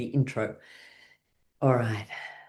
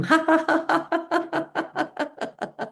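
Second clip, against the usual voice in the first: much higher, wild laughing ha ha; laughing tantrum; slower than usual. Shown as a demonstration. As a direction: throw a playful laughing fit. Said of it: "You can have a laughing tantrum".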